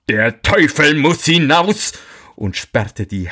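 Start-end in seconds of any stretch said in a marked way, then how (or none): 0.1-1.9 higher, urgent exclamation; alarmed command. A narrator's reading. Miller learns a devil is in his house, alarmed command. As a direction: order it out with raised pitch.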